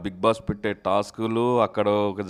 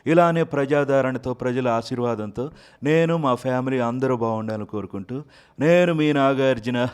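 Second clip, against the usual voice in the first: a smooth, charming star voice, a bit louder; Nagarjuna impression; 3 semitones higher, 4 dB louder than usual. Mimic voices Nagarjuna thanking fans, smooth and charming. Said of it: "Nagarjuna గారు voice ఏదో ఒక డైలాగ్తో start చేయండి".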